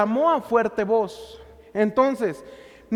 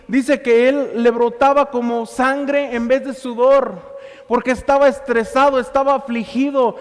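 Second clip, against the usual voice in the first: loud, driving sermon voice; preaching at full force; 6 dB louder than usual. Acted. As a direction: preach it out to the congregation, full power. (predicando con toda la fuerza)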